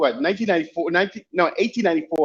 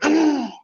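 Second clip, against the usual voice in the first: surprised gasp; 11 semitones higher than usual. Retold or imitated. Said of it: "she went".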